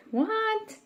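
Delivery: sharp high startled what, kept quiet; mother hearing her son hit a child, asking in disbelief; shocked what. Retold or imitated. Mom told her son punched a boy, a startled what.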